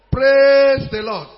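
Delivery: loud, long-held PRAISE; slow, booming praise call; rousing call. Real, not acted. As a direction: stretch Praise out loudly to rouse the congregation. Repeats call to rouse congregation.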